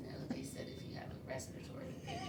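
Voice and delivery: quick strained whisper; swollen throat voice. Real, not acted. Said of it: "my throat is swollen".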